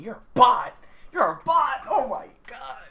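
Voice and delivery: loud, high-pitched jeering outburst; exasperated yell. Real, not acted. Jeers at an unquestioning officer.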